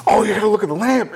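high, quick, eager burst; mock-excited greeting at speed; excited lady. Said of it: "she gets all fucking excited too".

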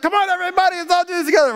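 high, bouncy, hammed-up cheer; rally the room like a perky instructor; mock cheery group leader. Pastor mimicking a class leader, high playful cheer.

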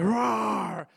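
mild imitation lion roar; family-friendly lion roar; best PG roar. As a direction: give a tame, drawn-out mock roar. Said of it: "roaring in my best roar".

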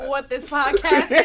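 laughing, slow; words through a laugh. Real, not acted. Laughing while answering.

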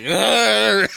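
put-on loud, high drunk "argh"; drunken growl. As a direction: groan out like a drunk biker. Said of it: "the drunk guy is like".

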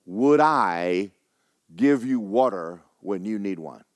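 ordinary talk

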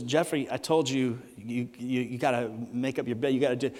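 low, soft scolding; lightly voiced mother, pitched well down and quiet; mild mom voice. Mom telling her son to make his bed, mildly scolding. Mom's everyday telling-off, acted.

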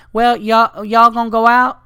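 high, loud, pushy Southern voice; pushy Southern girl. Retold or imitated. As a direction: press them to come out. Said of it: "she was, like, a little aggressive".

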